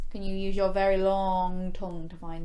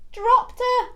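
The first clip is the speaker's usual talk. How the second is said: higher, louder punchline drop; dramatic reveal; 7 dB louder than usual. Pretend. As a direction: gasp it out as she falls.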